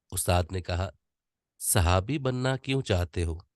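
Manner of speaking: controlled story reading. Shown as a demonstration. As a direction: paint the scene, stopping where it's natural. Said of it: "controlled speed में पढ़ रहा हूं". (ठहराव के साथ धीमी रफ्तार में कहानी सुनाना)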